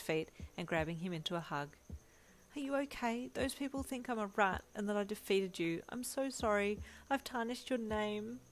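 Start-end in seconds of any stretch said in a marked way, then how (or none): 2.5-8.4 higher, upset pleading; anguished apology, higher; distressed cry. A narrator's reading. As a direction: cry out in guilt. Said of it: "you cried".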